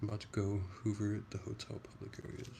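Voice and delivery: quick, hushed reading under the breath; whispered comment read. Real, not acted. Streamer reading a chat comment to herself, hushed. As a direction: murmur it quickly to yourself. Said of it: "I just whispered that one".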